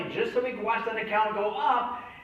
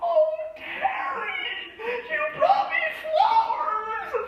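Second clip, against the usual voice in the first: much higher, slow, shouting with joy; overjoyed mom; 5 dB louder, much slower than usual. Retold or imitated. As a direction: squeal like a mother moved by a gift. Mom overjoyed receiving flowers.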